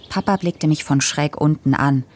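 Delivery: ordinary delivery, nothing marked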